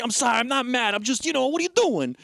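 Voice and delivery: mock anger climbing in pitch; mad at her. Imitating a husband who says he's not mad, rising irritation. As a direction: start high and rise to an exasperated demand. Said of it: "he's mad at her".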